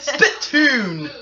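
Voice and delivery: loud laughing cry; laughing shout. Real, not acted. Laughing at the name.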